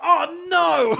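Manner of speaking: high, loud mock yelp of pain. Villain shot in the knee, hammed-up yelp.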